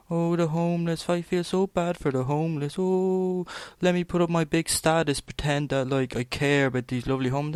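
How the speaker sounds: high, sugary mock sympathy; mocking fake pity. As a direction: sneer at the showy Facebook pity. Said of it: "people put up, like".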